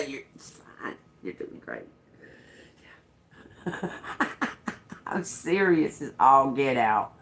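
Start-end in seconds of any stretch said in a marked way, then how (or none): 0.1-1.9 breathy fake reassurance; whispered mock encouragement. To the bandmate who can't sing, a whispered mock pep talk. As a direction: whisper it, sweetly insincere. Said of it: "I would be like".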